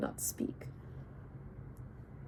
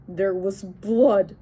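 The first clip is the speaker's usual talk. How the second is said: snarling; 6 dB louder, much slower than usual. Acted. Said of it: "I snarled".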